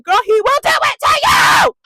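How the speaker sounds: very high, loud yell; high-pitched hammed-up shouting; exasperated shouted retort. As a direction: holler it back, drawing out the end. Shouting back at the retold character.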